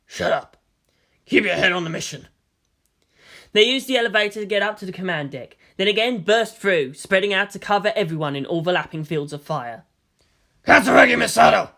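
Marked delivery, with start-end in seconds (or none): 0.1-2.3 stern squad leader; curt order. Acted. Team leader silences a nervous soldier, stern. 10.6-11.7 loud commanding shout. A narrator's reading. Delta One shouts at Misato, loud command. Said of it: "Delta One shouted".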